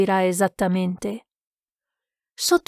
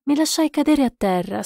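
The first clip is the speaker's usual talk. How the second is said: ordinary delivery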